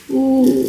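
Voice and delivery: high, drawn-out ooh of pain. Real, not acted. Woman peeling off a painful face mask, pained ooh. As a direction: let out a high wince of pain.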